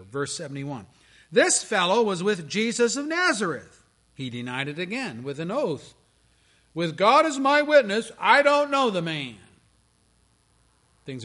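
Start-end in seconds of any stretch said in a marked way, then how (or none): 1.3-3.8 louder, higher accusation; mock accusing call; accusing gatekeeper. 6.7-9.4 loud, high desperate oath; hammed-up sworn denial; oath-swearing denial.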